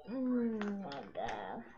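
hoarse, strained after coughing; raging voice. Real, not acted. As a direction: croak it out with a sore throat. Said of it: "My raging voice".

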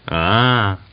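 a drawn-out, knowing sound; understanding drawl. A narrator's reading. Unwelcome kinsman grasping he is refused, drawled. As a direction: stretch it as realisation lands. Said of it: "Mitka drawled in an understanding tone".

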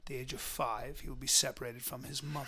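Uncle Angus from Braveheart. Acted. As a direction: narrate it softly like an epic film. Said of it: "you sound like Uncle Angus from".

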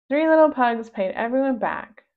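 ordinary delivery, nothing marked

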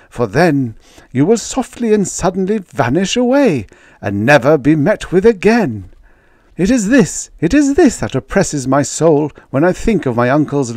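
high, dread-filled warning; dire warning. Retold or imitated. Baker voicing his uncle's ominous warning, high and fearful. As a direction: warn with mounting dread.

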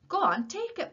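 loud, high, brisk prompt; urging. A narrator's reading. Tom to dumbfounded Willie offered an apple, urging. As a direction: push him firmly to accept.

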